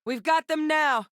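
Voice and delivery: high, eager exclamation; excited, pitch way up; triumphant battle cry. Acted. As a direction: crow that victory is close. Triumph in a fight.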